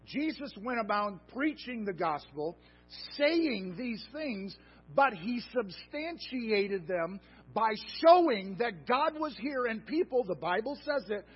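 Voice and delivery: from firm to loud and high preaching; sermon rising to a peak; building preaching. Acted. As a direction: climb to a shouted authority. Preaching builds to full force.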